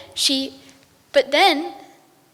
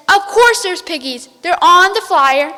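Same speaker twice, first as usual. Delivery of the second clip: angry witch; 4 semitones higher, 8 dB louder than usual. Acted. Witch insists.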